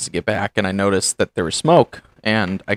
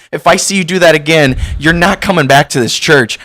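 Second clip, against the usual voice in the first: stern warning; 5 semitones higher, 6 dB louder than usual. Pretend. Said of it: "And just be like".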